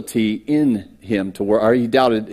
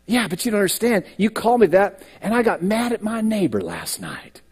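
higher whiny voice of a guilty believer; whiny excuse; 8 semitones higher than usual. Retold or imitated. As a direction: plead like a believer making excuses.

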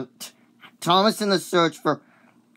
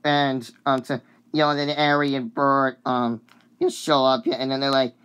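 normal talking voice

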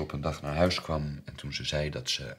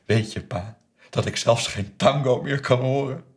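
higher, louder, rising reproach; bitter accusation; 6 semitones higher, 9 dB louder than usual. Retold or imitated. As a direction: throw it in your father's face. Daughter's bitter outburst, acted. (fel, luid verwijt aan vader)

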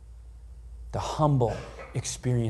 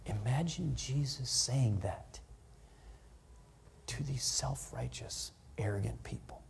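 hushed; 9 dB quieter than usual. Real, not acted. Hushed sermon aside.

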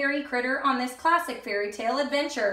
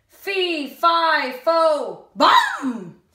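loud, slow giant chant; booming giant; 3 semitones higher, 7 dB louder, much slower than usual. Acted. Mister Giant sniffing the air, loud chant. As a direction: boom each word out.

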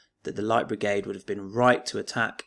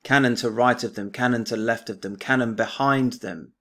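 poem recital; 6 dB louder than usual. Acted. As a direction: recite it like a stirring war poem. Teacher recites the poem aloud.

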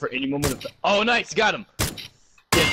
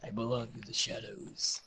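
mock sinister; 11 dB quieter than usual. Joking sneaky boast.

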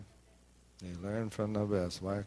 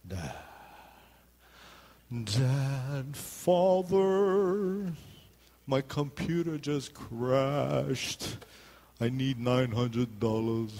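high, slow pleading son voice; mock-pitiful son asking dad for money; whiny son; 3 semitones higher, much slower than usual. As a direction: drag out the plea like a sad kid begging. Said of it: "world's saddest story of all time".

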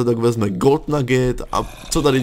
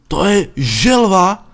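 amazed question; 5 semitones higher, 5 dB louder, much slower than usual. Real, not acted. Amazed at turtle.

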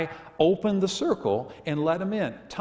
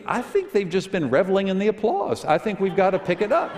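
normal talking voice

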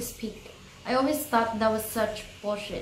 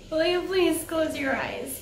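reading through tears, voice high; tearful; 5 semitones higher than usual. Real, not acted. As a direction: voice breaking while reading. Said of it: "I'm crying".

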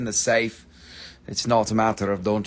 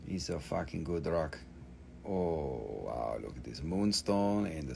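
quiet; 9 semitones lower, 13 dB quieter than usual. Real, not acted. Quiet praise of the rock.

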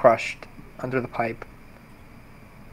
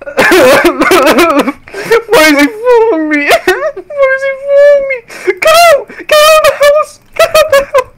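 shrieking, terrified crying; panicking gamer; 12 dB louder than usual. Pretend. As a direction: scream in hammed-up panic. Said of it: "can you demonstrate?".

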